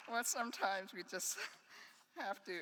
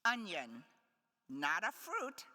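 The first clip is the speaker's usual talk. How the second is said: poem reading; 4 semitones higher than usual. Acted. Poet to audience, reading the fruit etudes. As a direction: give each fruit name its own weight.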